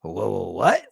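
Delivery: startled questions jumping up in pitch; hammed-up disbelief; mock shocked. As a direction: replay a double take, voice leaping on "what". Retells own startled reaction.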